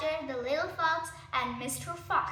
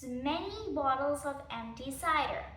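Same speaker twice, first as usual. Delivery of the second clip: ordinary delivery, nothing marked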